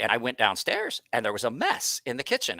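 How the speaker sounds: ordinary talk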